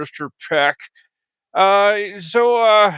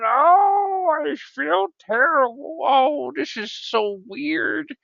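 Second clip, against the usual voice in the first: mock lament; 8 semitones higher, slower than usual. Lamenting mustard humiliation.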